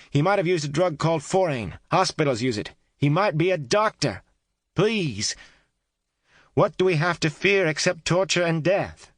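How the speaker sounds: high, loud urgent call. A narrator's reading. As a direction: call out with grim humor. Kate calling through wall.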